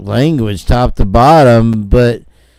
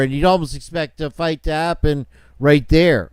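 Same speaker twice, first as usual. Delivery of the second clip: ordinary delivery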